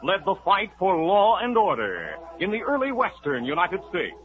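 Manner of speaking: big, rousing radio announcer read; announcer intro. Acted. Announcer opening the show for listeners, rousing and grand.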